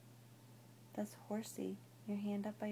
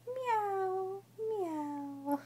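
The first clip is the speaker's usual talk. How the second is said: higher playful kitty meow; cat meow; 11 semitones higher than usual. Shown as a demonstration. Cat sound for toddler.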